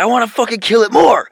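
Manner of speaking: high, loud cat voice. Retold or imitated. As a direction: voice the cat, eager to fight.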